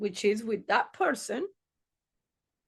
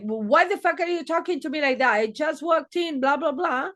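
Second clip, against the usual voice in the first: mock angry snap; 5 semitones higher, 4 dB louder, faster than usual. Coworker snapping back at her.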